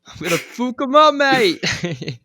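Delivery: hammed-up Irish brawler, high and loud; over-the-top Irish trash talk; mock Irish accent. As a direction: bellow it like a cocky Irish fighter. Mock Irish fighter quote.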